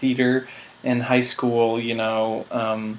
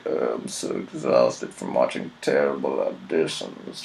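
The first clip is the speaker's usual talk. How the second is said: much lower, softer weary grumble; bored director; 12 semitones lower, 6 dB quieter than usual. Retold or imitated. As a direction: drone it like a jaded director.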